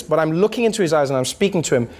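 ordinary talk, nothing marked

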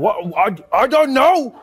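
high, loud, slow mock confusion; imitated officer at a total loss; baffled policeman. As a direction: stammer as a stumped cop. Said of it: "the cop was just like".

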